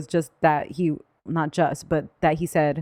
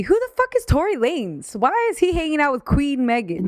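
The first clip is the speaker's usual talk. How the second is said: high-pitched indignant disbelief; incredulous; 8 semitones higher than usual. Real, not acted. Friend questioning Megan's choice of company, incredulous. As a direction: demand an answer in high, baffled outrage.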